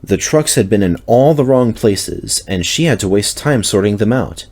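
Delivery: ordinary delivery, nothing marked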